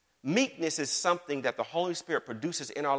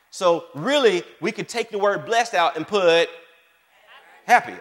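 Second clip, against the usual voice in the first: emphatic loud; 5 semitones higher, 7 dB louder than usual. Real, not acted. Emphatic teaching.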